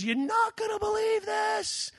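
high, slow thrilled shout of news; excited mother-in-law. Retold or imitated.

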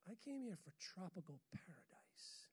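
mock pleading whisper; whispered, wistful retold complaint. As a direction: breathe it like a secret wish. Retold whining at the storm.

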